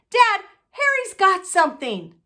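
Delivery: high, loud whiny tattle to dad; a spoiled boy telling on his cousin; tattling. Acted. Boy calling his dad to tell on Harry, high and loud.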